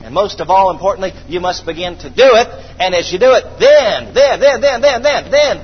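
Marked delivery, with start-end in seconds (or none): 2.8-5.6 higher, louder repeated then; building preacher cry. Acted. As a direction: climb with each then to the payoff.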